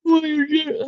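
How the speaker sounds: talking through a yawn; words cut off by a yawn. Real, not acted. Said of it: "I keep yawning".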